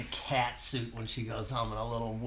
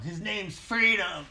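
slow, proud announcement, higher and louder; proud dog owner; 6 semitones higher, 4 dB louder, slower than usual. Retold or imitated. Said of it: "What's your dog's name?".

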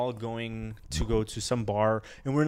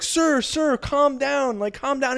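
raised, high voice pleading for calm; imitated urgent police; 12 semitones higher, 5 dB louder, slower than usual. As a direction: play an officer talking over a yelling drunk. Police trying to calm yelling friend.

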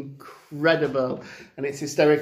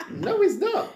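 laughing mock reply. Mimics filmmakers, laughing.

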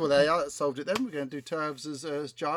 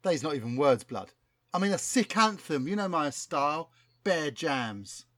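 lightly voiced London rapper, keen; a hint of a slangy London rapper; street rapper voice; 3 semitones higher, 4 dB louder than usual. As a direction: talk like a cocky MC pitching a hit. Plays rapper Chronic Cortex.

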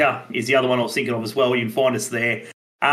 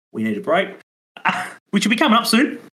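normal talking voice

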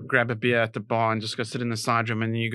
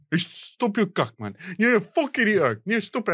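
higher mock scolding from the manager; manager yelling; 6 semitones higher than usual. Manager scolding the heckler in the club, mock angry. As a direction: play the manager telling off the heckler. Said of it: "the manager's like".

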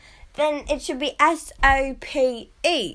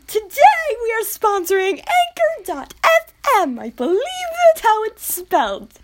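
put-on high, wobbly old-lady ad read; mock grandma announcer hamming up an ad; 9 semitones higher, 4 dB louder than usual. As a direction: read the sponsor plug like a grandma. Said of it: "And I sound like an old grandma".

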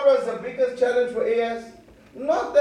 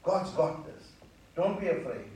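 soothing hush; 4 semitones lower, 13 dB quieter than usual. Acted. Prophet calming a frightened listener.

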